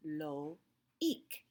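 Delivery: loic slowly as "lo", pause, "eek"; separate vowels. Shown as a demonstration. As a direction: say loic with a clear gap between French O and I. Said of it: "say the O and the E".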